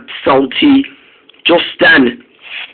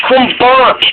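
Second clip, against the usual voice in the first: high, raised call after departing engines; 9 semitones higher than usual. Acted. Spencer calling after the two diesels, high raised call. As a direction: shout after them to come back.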